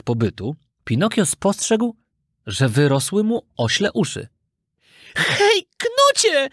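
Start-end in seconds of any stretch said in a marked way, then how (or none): 5.1-6.5 very high-pitched puppet call. A narrator's reading. Puppet hailing his schoolmate Knot, calling out. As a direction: call out to a friend in a piping voice. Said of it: "zawołał do kolegi".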